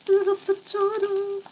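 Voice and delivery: cute voice. Pretend. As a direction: coo it like a pet name. Cute naming.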